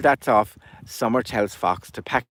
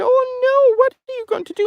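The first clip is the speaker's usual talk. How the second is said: very high, louder squeal of alarm; high frantic chicks crying out to Albert; panicked chicks; 7 dB louder than usual. Acted.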